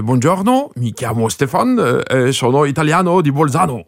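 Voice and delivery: put-on Italian phrases in a light German accent; mock South Tyrol German-accented Italian. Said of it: "z niemieckim akcentem". (lekki niemiecki akcent po włosku)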